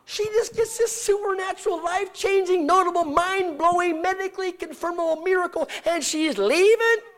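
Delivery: high, loud, outraged disbelief; incredulous. Retold or imitated. Preacher marvels at a healed woman walking out, loud high-pitched incredulity. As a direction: pile up the words, peaking loudly on the last.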